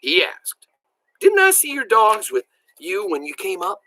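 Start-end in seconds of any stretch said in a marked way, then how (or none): 1.2-3.8 high-pitched, curious query; questioning. A narrator's reading. Grandpa asking Billy about his dogs, high questioning. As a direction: ask it lifted and wondering. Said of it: "With a questioning look".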